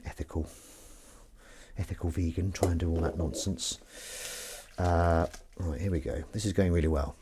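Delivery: ordinary delivery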